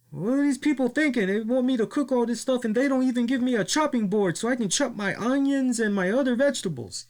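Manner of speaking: high, whiny mock complaint; imitating La Parka complaining. Mocking the wrestler griping about his kitchen, high and whiny. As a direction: whine about the missing chopping board in a high voice. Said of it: "he goes".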